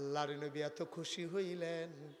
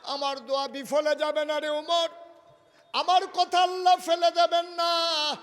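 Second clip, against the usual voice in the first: raised, insistent sermon cry; impassioned preaching; 13 semitones higher, 16 dB louder than usual. Acted. Preacher voicing the Prophet to Umar, impassioned preaching. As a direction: declare it with rising force.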